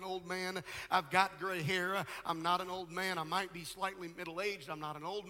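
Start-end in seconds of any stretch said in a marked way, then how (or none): none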